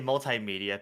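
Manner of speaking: "multimedia" as mul-tie-media; tie pronunciation. Shown as a demonstration. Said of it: "having the, the tie".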